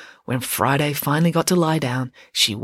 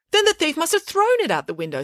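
a hint of a higher, fussy teacher; lightly voiced teacher; 14 semitones higher than usual. A narrator's reading. Mrs Cannon insisting to the girls, lightly voiced teacher.

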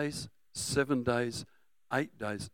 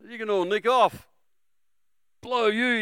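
loud Australian-style "nick off" retort; a hint of Australian, loud and cheeky; 9 semitones higher, 6 dB louder than usual. Preacher voicing Bartimaeus as an Aussie, loud. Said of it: "must have been an Australian".

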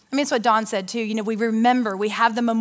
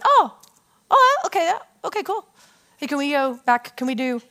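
put-on breezy kids; 3 semitones higher than usual. Kids react casually to news.

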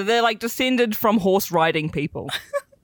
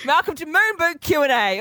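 talk show host; 7 semitones higher, slower than usual. Pretend.

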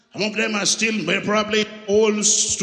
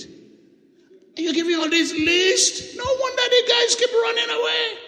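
incredulous laughing shout. Real, not acted. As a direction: cry out in amused disbelief.

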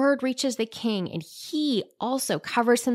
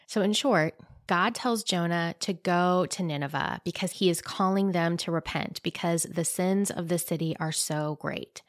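normal talking voice

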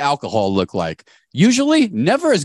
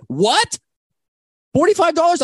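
shocked disbelief. Acted. Guest hears the forty-five dollar bill, hammed-up disbelief. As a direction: yelp the price back in mock outrage. Outraged at being charged for party.

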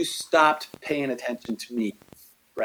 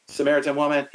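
ordinary talk, nothing marked

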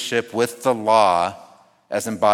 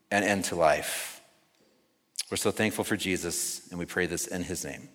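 prayer. Acted. Closing prayer.

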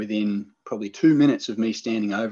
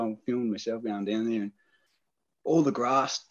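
normal talking voice

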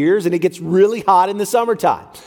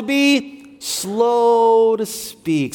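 slow demo; 2 semitones higher, much slower than usual. Shown as a demonstration. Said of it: "So here's our action".

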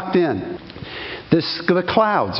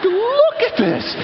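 high, slow amazement with a laugh; laughing awe; much slower than usual. Retold or imitated. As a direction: laugh through the wonder. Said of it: "I'm like".

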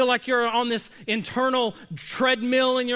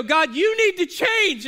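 high, loud, slow demand at God; whining complaint, louder and higher; petulant demand to God; mock demand; 8 semitones higher, 6 dB louder than usual. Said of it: "we go".

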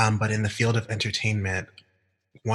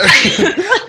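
tipsy giggle; 11 dB louder than usual. Real, not acted. Laughing after admitting tipsiness.

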